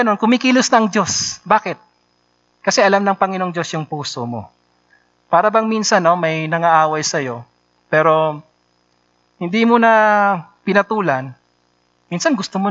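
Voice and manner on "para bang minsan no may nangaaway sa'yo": usual speaking voice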